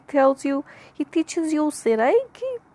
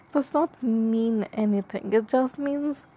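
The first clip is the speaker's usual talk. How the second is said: normal talking voice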